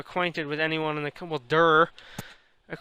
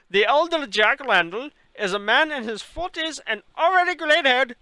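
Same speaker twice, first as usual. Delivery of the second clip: put-on Indian accent; 5 semitones higher than usual. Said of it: "talk this long with an Indian accent".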